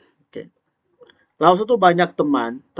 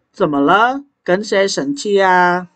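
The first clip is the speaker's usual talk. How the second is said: concerned question, pitched higher and louder; dramatic dialogue line; 6 semitones higher, 5 dB louder, slower than usual. Acted. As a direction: ask with hammed-up worry. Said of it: "dialog penuh drama ini".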